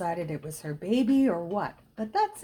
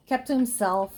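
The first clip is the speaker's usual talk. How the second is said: usual speaking voice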